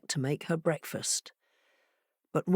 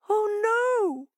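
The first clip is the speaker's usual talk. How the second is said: wail. A narrator's reading. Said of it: "wailed Hare".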